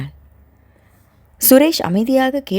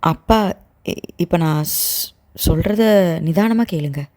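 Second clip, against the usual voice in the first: nervous daughter faltering before her father; hesitant; 3 semitones lower than usual. A narrator's reading. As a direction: stumble over the words as if afraid to begin. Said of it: "தயக்கத்துடன் பீடிகை போட்டவள்".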